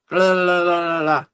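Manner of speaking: tongue-flapping "blah-blah" of distaste; imitated yuck noise, run together; disgusted blah noise. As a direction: shake off a nasty taste aloud. Imitates reaction to metal taste.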